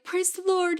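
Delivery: high-pitched imitated cry of praise; mock rejoicing. Mom praying in the car as child breathes, rejoicing. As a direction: lift it high like a thankful churchgoer.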